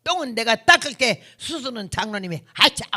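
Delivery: mock feisty comeback; forceful arguing. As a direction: argue back hard, as a retold quote. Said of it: "아주 말빨이 세요".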